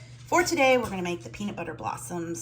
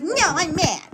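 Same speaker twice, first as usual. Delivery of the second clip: a hint of a pouty pug, louder and higher; voicing the dog; 9 semitones higher, 7 dB louder than usual. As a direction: speak for the grumpy dog. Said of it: "It's like".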